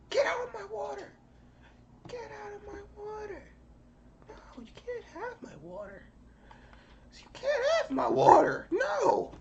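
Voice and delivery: squeaky, very high protest; high-pitched scolding. Real, not acted. Speaker guarding the water from an intruder, high squeaky scolding.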